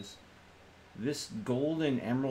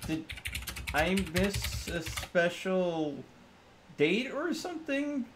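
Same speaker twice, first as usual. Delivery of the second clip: surprised question; 8 semitones higher, slower than usual. Acted. Puzzled by unexpected gift.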